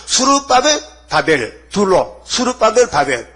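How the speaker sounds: preacher spelling out two names, 수르바벨 up and 바벨 down; contrasting names. Shown as a demonstration. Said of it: "바벨 둘이잖아".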